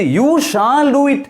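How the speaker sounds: high-pitched, insistent "You shall do it"; emphatic. Shown as a demonstration. Teacher shows learners shall meaning must, emphatic. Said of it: "Something very strongly pronounced or announced".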